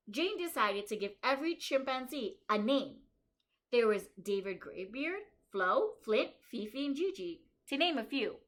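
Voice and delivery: bright, lively storybook reading for a class; reading to children. Acted. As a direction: read it out to the kids like a story. Reads picture book aloud.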